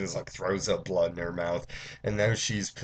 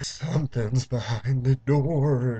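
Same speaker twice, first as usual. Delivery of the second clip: slow, higher, louder fake wail; mock crying; 4 semitones higher, 6 dB louder, slower than usual. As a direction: imitate a terrified girl wailing, drawn out. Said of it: "her just crying and stuff".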